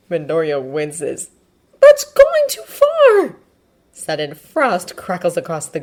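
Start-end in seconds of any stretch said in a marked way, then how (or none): 1.8-3.4 very high, loud, drawn-out objection; distressed protest. Acted. Midoriya objecting to his friend's accusation, distressed. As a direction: cry out slowly in a high boy's voice.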